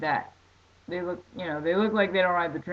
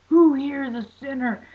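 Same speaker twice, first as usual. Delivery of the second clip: high, loud mock preacher challenge; mock preacher calling out sinners; preacher's challenge; 8 semitones higher, 7 dB louder than usual. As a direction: fire it at the crowd like a street preacher. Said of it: "he was like".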